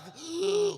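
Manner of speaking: sharp high gasp; a sudden jolted gasp; startled gasp. Retold or imitated. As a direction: gasp awake in alarm. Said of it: "I get up, I'm like".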